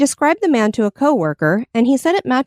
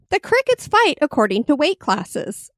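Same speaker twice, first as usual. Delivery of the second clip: ordinary delivery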